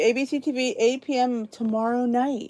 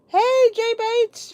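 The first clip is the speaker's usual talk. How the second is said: sing-song greeting; 12 semitones higher, 3 dB louder, much slower than usual. Real, not acted. Greeting a viewer in chat.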